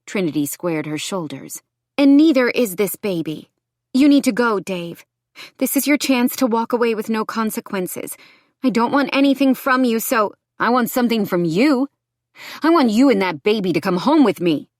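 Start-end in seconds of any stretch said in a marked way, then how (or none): 2.0-10.3 higher, louder, standing firm; defiant woman. Acted. Woman orders her abusive ex to leave, defiant. 10.6-14.7 lightly voiced menacing ex, raised voice; demanding ex-boyfriend. Ex-boyfriend demands woman and baby, lightly voiced menace.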